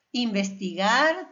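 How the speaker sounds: Investigar slowly, leaning on GAR; stress on last syllable. Shown as a demonstration. Teacher showing the última sílaba, Investigar stressed on GAR. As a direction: stretch the final GAR of Investigar. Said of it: "también en la última sílaba".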